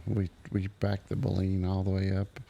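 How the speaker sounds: usual speaking voice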